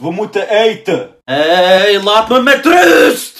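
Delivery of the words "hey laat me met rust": slow, loud bellow; furious yell. Acted. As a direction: bellow it out, fed up. Yelling at gaming kid.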